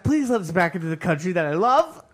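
high, loud pleading through a laugh; mock pleading. Self at customs, begging the officer, mock pleading. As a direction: beg to be let back in, half laughing. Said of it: "And I was like".

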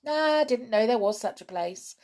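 a hint of a surprised hedgehog; hedgehog character. A narrator's reading. Narrator voices a hedgehog in a story.

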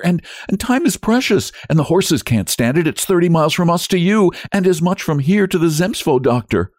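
high, urgent entreaty; frantic wife begging for help; desperate pleading. A narrator's reading. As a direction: argue frantically, voice pitched right up.

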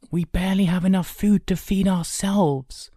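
put-on high-pitched boyish caution; warning. A narrator's reading. Brother cautioning the younger about scarce food, warning. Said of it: "Kay warned quietly".

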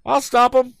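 high, loud firm declaration. A narrator's reading. Tom declaring to the frightened lady, firm and loud. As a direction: promise it with set teeth. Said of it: "declared the youth firmly".

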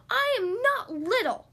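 high, loud, drawn-out protest; little duck hollering in protest; indignant holler. A narrator's reading. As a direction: holler it slowly, every word insisted on. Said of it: "Thistle hollered".

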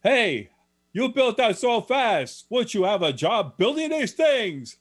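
high, loud, amazed Polish shopkeeper; Polish accent. Retold or imitated. Shocked Polish shopkeeper asking the lamp builder about his job, high Polish accent. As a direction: exclaim in a high-pitched imitation Polish accent. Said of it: "the Polish guy says".

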